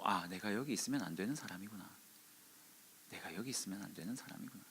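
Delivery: low, soft musing to oneself; quiet realization. Retold or imitated. Preacher as Elijah realizing his mistake, hushed.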